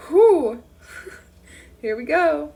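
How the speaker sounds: loud whoosh of relief then a laugh; loud relieved exhale and chuckle; relieved whew. Real, not acted. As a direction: blow out a big breath, laughing. Reader exhales after chapter.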